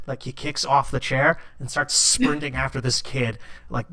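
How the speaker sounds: usual speaking voice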